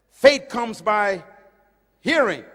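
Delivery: Faith comes by hearing, each word slow and loud; slow emphatic quote. Retold or imitated. Preacher quoting Paul slowly and loudly to the crowd. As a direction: lean hard on every word. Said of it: "He said".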